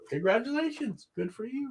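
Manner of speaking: high, breezy congratulations; high sing-song well-wishing; mock cheerful. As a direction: toss off congratulations lightly. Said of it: "I'm like".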